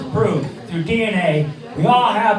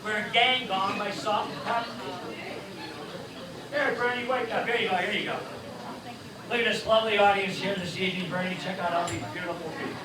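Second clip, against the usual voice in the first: ventriloquist host voice; 8 dB quieter than usual. Acted.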